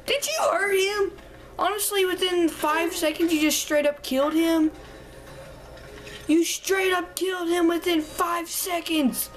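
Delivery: high, incredulous, slowing on the last lines; outraged disbelief. Acted. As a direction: scold the game character in mock shock. Outraged at the game character.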